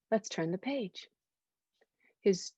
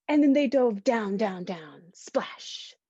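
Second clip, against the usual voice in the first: dropping down with the dive; slower than usual. A narrator's reading. Narrating dive down and splash.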